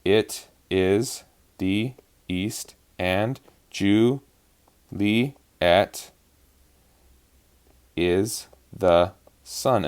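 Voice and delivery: slow, syllable-by-syllable scansion; very slow, with gaps between beats. Shown as a demonstration. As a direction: count out each syllable separately. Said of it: "let's just go through and mark".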